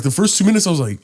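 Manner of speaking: usual speaking voice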